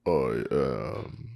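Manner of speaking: slow, low movie-villain rumble; lightly done Vader, slow and low; Darth Vader voice. Retold or imitated. Mic check as a slow, low Vader. Repeats the Vader line, slower.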